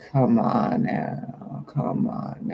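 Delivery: low-key, slow, breath-voiced wheedling; coaxing trickster. Trickster tempting a reluctant friend, slow hushed coaxing. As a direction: draw it out softly to tempt someone. Trickster coaxes her to give in.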